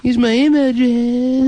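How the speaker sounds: high, stretched parody of lazy speech. Retold or imitated. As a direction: drag out the last word in mockery. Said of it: "It's like a lazy way to talk".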